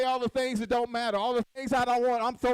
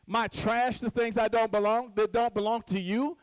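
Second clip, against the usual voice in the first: normal talking voice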